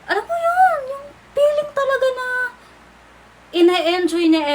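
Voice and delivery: high, loud, near-tearful gushing; mock gushing. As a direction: gush it up high like you're overwhelmed. Gushing about enjoying life. (malakas at matinis na kilig)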